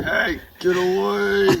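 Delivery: high, drawn-out mock yell; Owen's yell. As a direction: imitate shooing an animal off, high and stretched. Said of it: "Yeah, just yelled".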